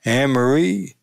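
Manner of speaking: light imitation of a Southern accent; a hint of cultivated Southern accent; put-on Southern accent. Al Davis greeting the commissioner's daughter, put-on Southern accent. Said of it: "Southern accent he cultivated in South Long Island".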